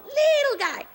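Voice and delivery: very high and loud; tiny squeaky voice. Acted. Teller describing a tiny bar customer, squeaking high. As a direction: squeak it to show how little he is. Showing how tiny the man is.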